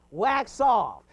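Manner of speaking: normal talking voice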